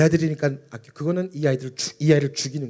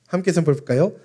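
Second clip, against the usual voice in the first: ordinary talk, nothing marked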